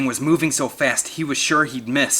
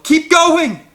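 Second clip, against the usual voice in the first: high, loud cheering yell; encouraging yell; 11 dB louder than usual. A narrator's reading. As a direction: cheer him on from afar. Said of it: "Eric yelled".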